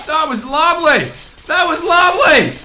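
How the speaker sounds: high loud praise; excited cheering. Real, not acted. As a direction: cheer it through. Excited urging.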